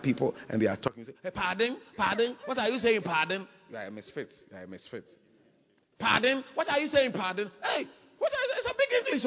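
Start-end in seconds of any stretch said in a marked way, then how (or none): 1.2-3.5 high-pitched mock "pardon?"; acted bewilderment. Acted person not understanding English speakers. 3.7-5.0 very soft, low muttered verdict; hushed aside. Acted. Aside judging the acted president. 6.0-9.2 very high squeaky mock confusion; acted bewilderment. Acted person baffled by big English.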